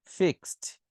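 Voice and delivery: "fixed" said "fikst"; X plus T ending. Shown as a demonstration. Teacher remembering the X rule, "fixed" ending in T. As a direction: end "fixed" on a crisp T. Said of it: "O X".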